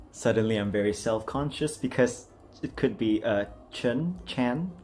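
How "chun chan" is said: ordinary delivery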